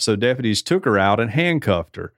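ordinary talk, nothing marked

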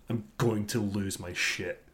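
ordinary talk, nothing marked

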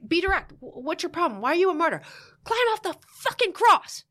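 loud, high, building to an outburst; acted-out therapist tearing into a client; blunt confrontation. Therapist berates wife for playing martyr, angry and blunt.

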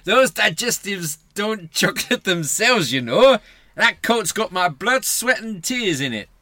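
loud, high Geordie rant; Geordie accent. Acted. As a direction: rant like a put-upon Geordie husband. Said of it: "you went quite Geordie".

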